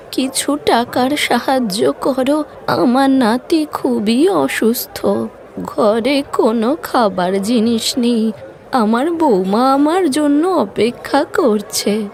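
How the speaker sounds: put-on frail old woman pleading for help; old beggar woman. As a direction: beg slowly like a hungry old woman. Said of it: "একজন ক্ষুধার্ত বুড়ি মানুষ কোমলের কাছে খাবার চেয়ে বলে".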